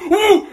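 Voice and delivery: very loud, high whoop of fright; retold high-pitched "Woo!"; startled shriek. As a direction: imitate a scared scream. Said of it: "So, so loud that she scares".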